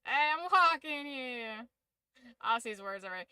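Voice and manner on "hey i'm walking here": put-on New York accent. Streamer parodying New Yorkers, exaggerated New York accent. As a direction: call it out like a stock New Yorker. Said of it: "that's very New York".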